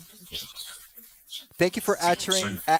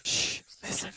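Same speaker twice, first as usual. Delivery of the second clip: hushed breathy shh; whisper; 9 dB quieter than usual. Real, not acted. Hushing the others to listen for a voice, whispered. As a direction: quietly hush the room so the box can be heard.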